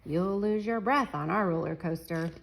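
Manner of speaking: usual speaking voice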